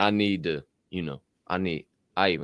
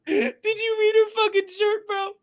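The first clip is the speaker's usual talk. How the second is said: very high, squealing near-crying laughter voice; hysterical disbelief; 9 dB louder, slower than usual. Real, not acted. Co-host cracking up over a woman's shirt, hysterical squeal. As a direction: squeal it through helpless laughter.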